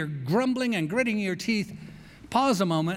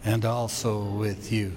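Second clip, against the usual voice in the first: reverent group response; liturgical response; 7 semitones lower than usual. Real, not acted. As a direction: answer together, steady and reverent.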